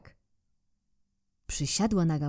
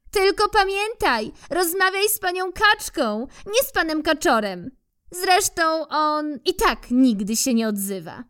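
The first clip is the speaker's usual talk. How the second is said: squirrel from treetop; 10 semitones higher, 3 dB louder than usual. Acted.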